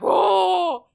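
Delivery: high, loud astonished whoa; amazed whoa. Retold or imitated. Kids back then marvelling at ten megabytes, a loud amazed whoa. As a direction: gasp out a big impressed whoa.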